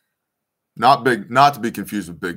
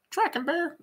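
much higher, playful squeak; squeaky high voice. Real, not acted. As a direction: ask it in a tiny squeaky voice. Squeaky voice asking dog.

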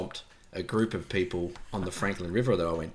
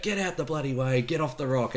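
higher-pitched mock yell, only mildly raised; retold yell; 5 semitones higher than usual. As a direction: imitate a group heckle without full volume. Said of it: "a few of us yelled out".

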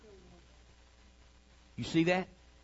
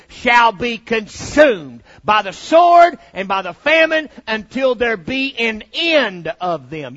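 preaching; 5 semitones higher, 10 dB louder, slower than usual. Acted. Scripture read forcefully.

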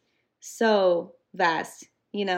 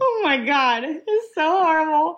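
high and loud, overcome; anguished exclaim; 9 semitones higher, 5 dB louder than usual. Real, not acted. Podcaster overwhelmed recalling the episode, high anguished outcry. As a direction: cry out at how awful it is. Reacting to heartbreaking scene.

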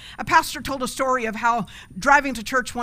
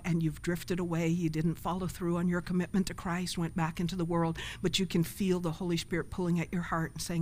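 soft, gentle invitation to come forward; altar call; 5 semitones lower, 7 dB quieter than usual. Acted. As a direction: coax them quietly toward faith.

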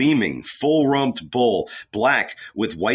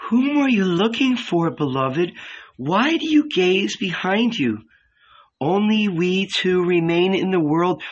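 higher, tender woman's voice, rising to a cry on come; Magdalene, adoring; 7 semitones higher than usual. Acted. Magdalene draws Jesus away, high and adoring. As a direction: voice a devoted woman calling her beloved.

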